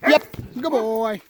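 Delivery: high, bright praise; praising the dog. Real, not acted. Trainer praising his dog as it comes back, high praise. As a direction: cheer the dog on.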